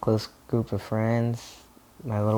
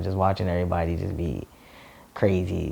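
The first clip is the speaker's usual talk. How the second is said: ordinary delivery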